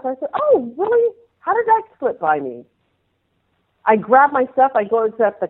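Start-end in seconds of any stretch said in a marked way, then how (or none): 0.3-2.7 mock surprise. Retelling her own surprise.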